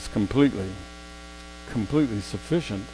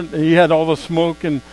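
ordinary talk, nothing marked

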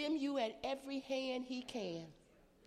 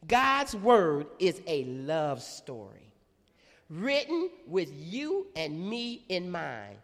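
slow emphasis; 6 semitones lower, slower than usual. Real, not acted. Preacher slows for key line.

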